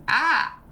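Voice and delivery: high-pitched, short "ah" of alarm; startled yelp. Real, not acted. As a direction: cry out as if something just broke. Startled by gizmo malfunction.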